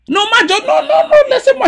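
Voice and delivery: high, loud cry of no; excited protest. Real, not acted. Protesting laughing interruption.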